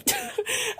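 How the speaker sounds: short high laugh at herself; high breathy laugh. Real, not acted. Laughing at herself about being out of shape, high laugh.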